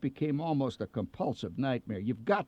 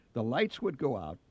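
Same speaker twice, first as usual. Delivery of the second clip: ordinary delivery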